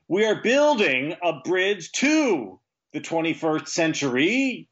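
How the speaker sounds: slow, high mock speech with odd stress on each word; imitating wrong emphasis. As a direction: stretch it out and hit the wrong words. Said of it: "she puts the emphasis on the wrong words".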